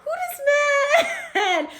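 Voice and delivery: high, laughing, shrieking disbelief; shocked friends. Retold or imitated. Friends shocked to see her with a man, high laughing shriek. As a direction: squeal it like a stunned friend, cracking up. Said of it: "my friends are like".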